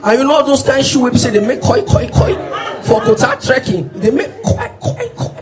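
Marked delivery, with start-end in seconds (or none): 1.8-2.3 squeaky shoe noise. Retold or imitated. 4.8-5.3 high soft squeak of a shoe; high squeaky shoe noise. Retold or imitated. Comedian imitating his squeaking shoes on the long trek, high and soft.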